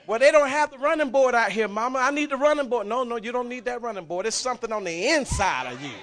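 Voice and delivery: high, very loud preaching shout; shouting preacher climax. Acted. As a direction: lift the church with a full shout. Preaching the eaglet's escape.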